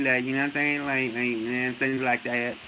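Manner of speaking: low, soft, dragging mumble in imitation; Errol Spence. Showing how the boxer talks, low mumbled impression. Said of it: "He talk like this".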